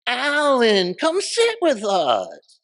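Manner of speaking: high, long-drawn hollered invitation; mock-sweet holler across a room; imitating Mitzi. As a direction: call out loud and high, stretching each word. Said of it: "this is how funny she could be".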